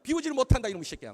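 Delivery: loud, high burst that drops at the end; scolding outburst. Retold or imitated. Pastor explaining himself to kids, exasperated scolding. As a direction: blurt it out in mock exasperation. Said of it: "야단을 쳤습니다".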